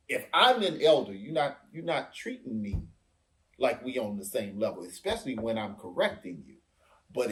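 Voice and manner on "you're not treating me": normal talking voice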